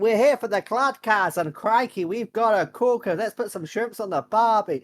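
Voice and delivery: put-on a loud, high, overdone Aussie accent, hosting like an ocker; bad Australian accent. [name] opening the podcast with a bad Australian accent. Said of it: "who did a bad impression of an Australian accent".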